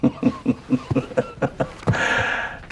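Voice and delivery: long affectionate chuckle. Acted. Husband relieved by wife's confession, laughing. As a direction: laugh it off gently.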